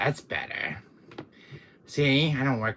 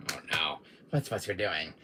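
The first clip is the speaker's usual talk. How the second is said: ordinary talk, nothing marked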